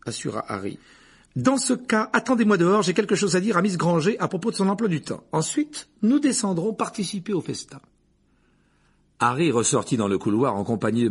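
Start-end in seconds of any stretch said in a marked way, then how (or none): 1.4-7.8 high, loud voice of authority; strict professor. A narrator's reading. Professor sending the boy outside to wait, commanding. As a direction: give orders firmly in a raised schoolmistress voice.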